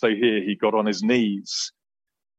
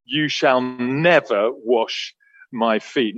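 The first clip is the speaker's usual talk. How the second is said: slow, weighty stress on "shall" and "never"; mock Peter digging his heels in, slowly; "You shall never wash my feet" said slowly, each word pressed; emphatic; 2 semitones higher, much slower than usual. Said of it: "very emphatically".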